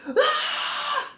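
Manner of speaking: high startled scream broken by a laugh; half-laughing mock scream of fright; scared shriek. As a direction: shriek like the sister seeing the gator dart. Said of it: "Kaylee go".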